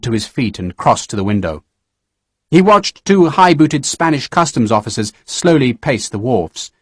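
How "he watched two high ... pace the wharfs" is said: ordinary delivery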